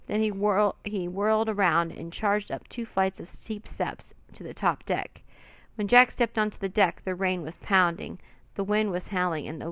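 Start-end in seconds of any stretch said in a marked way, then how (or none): none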